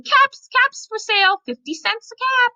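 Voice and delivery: high, sustained street-seller's call; calling out wares. A narrator's reading. Peddler walks back to town, calling out caps for sale. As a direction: cry your wares in a high voice. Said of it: "He walked back to town calling".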